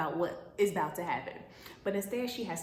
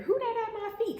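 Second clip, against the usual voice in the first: high, louder startled ask; startled question; 14 semitones higher, 4 dB louder than usual. Acted. Boaz finding someone at his feet, high startled. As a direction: jump at who's there.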